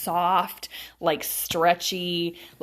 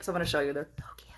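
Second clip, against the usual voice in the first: soft, hushed little gush; whispered delight; 4 semitones lower, 6 dB quieter, much faster than usual. Real, not acted. Showing viewers her new pants, in a whispered gush. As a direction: whisper it like a secret treat. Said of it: "showing off new pants".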